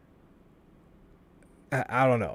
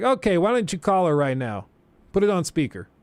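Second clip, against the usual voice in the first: demanding professor; 6 semitones higher, 3 dB louder than usual. Retold or imitated. Professor voiced in retold story.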